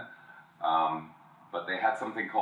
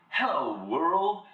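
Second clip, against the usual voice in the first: lightly voiced upbeat ad guy, rising high on world; cheery hello; 7 semitones higher, 3 dB louder, much slower than usual. Super positive guy stepping outside, chirpy hello. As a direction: greet the morning brightly. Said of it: "he goes".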